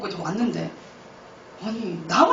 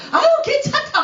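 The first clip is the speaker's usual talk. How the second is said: put-on high, loud kindly old lady voice; elder's reassurance; 5 dB louder than usual. An older woman reassures the sick young man, high and cheery. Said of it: "막 이러면서".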